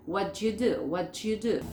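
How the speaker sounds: WHAT YOU run together as "whatchu"; repeated demo of "whatchu do"; CH sound joining WHAT and YOU; native assimilation. Shown as a demonstration. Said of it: "Cualquier nativo te va a decir".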